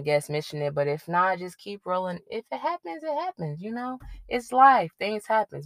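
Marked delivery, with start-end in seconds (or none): 2.3-5.6 light, carefree, higher voice; breezy shrug. Real, not acted. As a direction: shrug it off airily, pitched up. Shrugging off mistakes.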